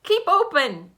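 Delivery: high, very loud yell. A narrator's reading. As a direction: yell the order. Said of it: "yelled Doctor DeSoto".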